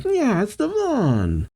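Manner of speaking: high, slow put-on character voice; voiced pirate character. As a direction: draw it out high, like a cartoon pirate. Pizarro's reply voiced in recap.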